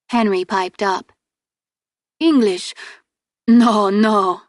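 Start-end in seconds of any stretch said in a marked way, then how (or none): 2.2-4.4 put-on broken English in a thick Finnish accent. A narrator's reading. Henry laughing off his poor English, strong Finnish accent. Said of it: "he has a very strong Finnish accent".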